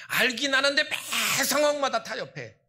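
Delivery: loud, high scornful jab; scornful emphasis. Real, not acted. Pastor scolding compromise, loud and high. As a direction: hit them with it.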